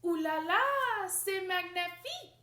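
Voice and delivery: high, loud mock French gushing; French admirers. European fans swooning over Annette, French accent.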